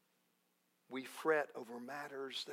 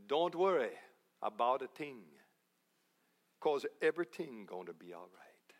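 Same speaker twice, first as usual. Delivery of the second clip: easygoing Jamaican lilt, dropping lower; Jamaican accent; 6 semitones lower than usual. Acted. Preacher rephrasing Bible reassurance for his congregation, in a put-on Jamaican accent. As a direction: say it with a laid-back Jamaican accent. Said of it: "in Jamaica, they would say it a little differently".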